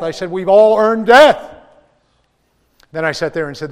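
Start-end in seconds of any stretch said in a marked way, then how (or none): 0.3-1.4 slow, much louder and higher, hammering each word; full-force preacher's cry; preaching shout. Acted. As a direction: thunder it from the pulpit. Preacher driving home the point.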